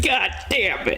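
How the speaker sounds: usual speaking voice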